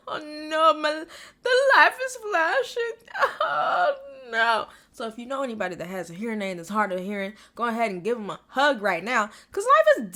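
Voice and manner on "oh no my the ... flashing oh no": teary, higher wail with laughter; half-laughing cry. Real, not acted. Fan choking up over the hearing aid scene, teary. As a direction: let it crack between laughing and crying. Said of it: "definitely gonna cry".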